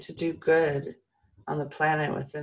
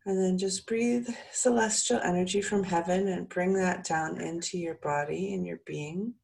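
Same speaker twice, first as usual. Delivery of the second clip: calm soothing voice; 2 semitones higher than usual. Acted. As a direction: ease them into calm. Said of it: "let my voice calm you and soothe you".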